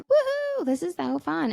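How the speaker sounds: squealing high woohoo, gleeful; excited kid. Acted. Kid in the bathtub cheering while splashing, gleeful and high. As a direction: play the kid having a blast in the water.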